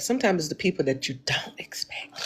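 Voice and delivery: hushed whisper; whispered aside to the audience. Real, not acted. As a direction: let it drop to a secret whisper. Secret-telling aside to viewers.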